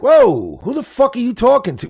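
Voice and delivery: high, offended mock outrage; high, offended who the fuck; indignant innocent. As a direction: bristle like an innocent man. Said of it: "You would go like".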